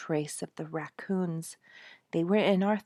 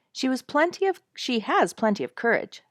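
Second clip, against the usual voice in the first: lightly emphatic, higher and louder; 8 semitones higher, 6 dB louder than usual. A narrator's reading. Husband defending his daughter's courage, emphatic. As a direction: insist on her courage. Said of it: "Dick said emphatically".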